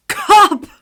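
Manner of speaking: shouted high in shock; startled exclamation. Acted. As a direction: yelp in fright. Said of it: "Remus exclaimed".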